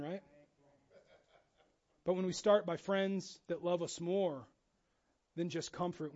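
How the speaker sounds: ordinary talk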